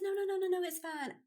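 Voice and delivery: high, quick no no no; high flustered denial; flustered reassurance. Retold or imitated. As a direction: rush to reassure her, voice up high. Reassuring stranger in retold scene.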